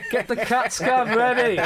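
laughing loudly through the words; cracking up mid joke. Real, not acted.

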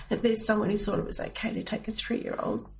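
ordinary talk

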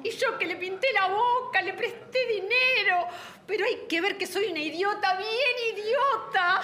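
high, rising self-reproach, dragging out the last words; bitter self-scolding. Acted. A betrayed woman berating herself, bitter and high. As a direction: call herself an idiot in hurt fury.

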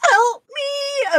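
very high, drawn-out mock wail; mock cry for help. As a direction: wail it helplessly, hammed up. Mock plea over reading difficulty.

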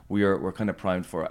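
ordinary talk, nothing marked

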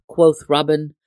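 usual speaking voice

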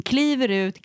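ordinary delivery

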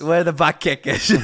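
loud, high mock Boston accent, laughing. Co-host echoing the shopper bit, laughing Boston accent. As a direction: echo it in broad Boston, cracking up. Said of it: "Very Boston".

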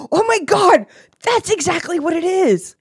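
high, loud gasping delight; excited surprise. Real, not acted. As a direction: blurt out the discovery. Sudden recognition, gasp.

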